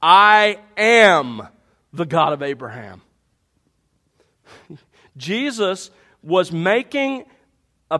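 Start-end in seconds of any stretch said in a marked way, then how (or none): 0.0-3.0 a loud, weighty "I am", then "the God of Abraham" low; emphatic I am. Acted. God declaring to Moses, loud on "I am". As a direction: let "I am" ring out like God speaking. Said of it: "God says to Moses".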